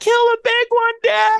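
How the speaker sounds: put-on high, loud little girl calling after the truck; daughter's high farewell shout. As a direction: shout it like a tearful kid chasing the truck. Daughter voiced crying goodbye.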